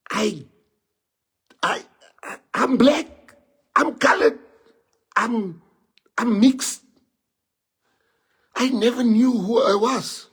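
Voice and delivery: real upset pushing the voice up and out; emotional. Real, not acted. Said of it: "I'm even getting emotional".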